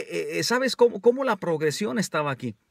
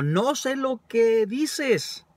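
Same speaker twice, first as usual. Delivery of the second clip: slow, higher, stretched-out words; emphatic quoted denial; 5 semitones higher, much slower than usual. Retold or imitated. Preacher quoting Peter's denial to the congregation, slow and emphatic. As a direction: draw out NO SÉ LO QUE DICES, pitched up.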